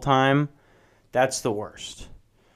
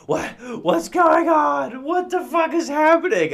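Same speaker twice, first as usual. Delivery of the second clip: family members yelling as they are dragged out by ropes; panicked yelling. Pretend. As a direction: shriek in bewildered terror while being dragged off.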